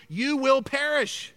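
ordinary talk, nothing marked

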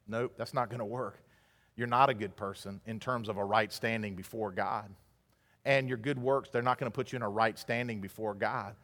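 low, hushed and matter-of-fact; soft, low-pitched refusal; quiet blunt truth. Acted. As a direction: drop right down and let them down firmly. Voicing blunt truth to the religious.

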